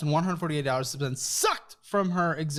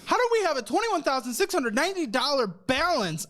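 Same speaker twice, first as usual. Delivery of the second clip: incredulous; 6 semitones higher than usual. Real, not acted. Host aghast at guest's debt.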